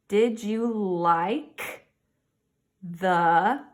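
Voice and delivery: very slow, word by word; slow sounding out. Shown as a demonstration. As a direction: stretch each word while decoding. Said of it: "teacher sounds out the question".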